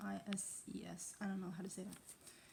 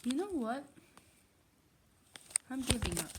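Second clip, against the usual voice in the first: frustrated outburst; 3 semitones higher, 5 dB louder than usual. Real, not acted. Gives up on the accent.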